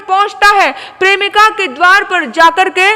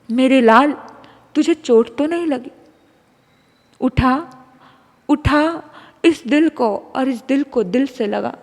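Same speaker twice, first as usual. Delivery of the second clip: tender mother's heart; 2 semitones lower, 6 dB quieter than usual. A narrator's reading. Mother's heart speaks to son.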